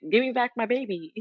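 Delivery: a lightly voiced protective mom; mock protective mom. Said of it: "I'm like".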